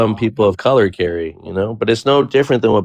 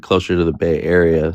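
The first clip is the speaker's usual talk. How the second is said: usual speaking voice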